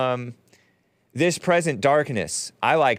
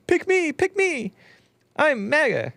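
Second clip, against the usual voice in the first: much higher squeaky mocking voice; squeaky mock Vivek. Host mocking the candidate in a squeaky pleading voice. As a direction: squeal it like a desperate child. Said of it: "His voice isn't that high".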